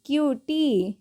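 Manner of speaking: क्यूटी modelled with a long ee at the end; teacher sounds out क्यूटी; slow model. Shown as a demonstration. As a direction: draw out the ee of क्यूटी. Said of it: "उसी तरीके से क्यू".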